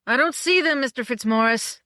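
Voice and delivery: high, loud call, fading on the name; a script boy calling out to the director. A narrator's reading. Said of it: "the script boy said".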